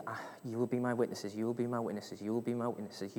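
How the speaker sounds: low, soft repeated recitation; quiet, low, absent reciting; recited to himself. Acted. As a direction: murmur the verse over to yourself. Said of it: "he was just saying this".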